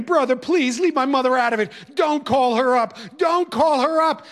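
mock wail, a boy begging his teacher; wailing plea. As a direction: plead not to have his mother called. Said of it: "And the wailing started again".

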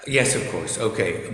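ordinary talk

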